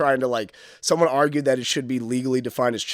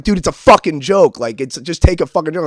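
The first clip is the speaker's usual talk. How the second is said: confrontation; 4 semitones higher, 8 dB louder than usual. Retold or imitated. Face-to-face fight with heckler.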